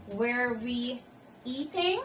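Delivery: pause, then lift "eating" as a question; rising question intonation. Shown as a demonstration. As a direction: model question climbing on "eating". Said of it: "the intonation will rise at the end".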